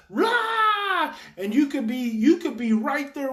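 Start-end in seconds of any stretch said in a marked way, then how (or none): 0.1-1.1 loud, high imitated child's roar; imitating a worked-up child's yell; child's outburst roar. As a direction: let out a mock tantrum roar. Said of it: "Your child could be doing this".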